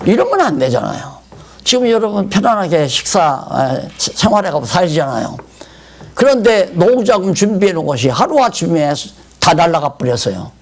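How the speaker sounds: ordinary talk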